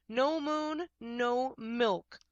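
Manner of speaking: loud stubborn cow bellow. A narrator's reading. Cow Martha refusing Rob, bellowing. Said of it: "she bellowed".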